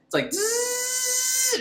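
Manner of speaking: high, slow buzzing imitation; mock zipping sound effect; buzzing sphere noise. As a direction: buzz like a flying orb. Imitating laser ball buzz.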